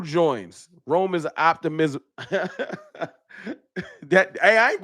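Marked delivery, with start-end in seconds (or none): 2.1-2.7 laughing. Real, not acted. Laughing at old nickname.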